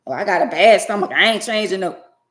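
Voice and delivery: hammed-up groan of a made-up excuse; mocking whiny excuse. Mocking excuses of lazy children.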